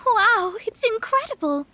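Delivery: impressed. Acted. Astrologist smells the aroma.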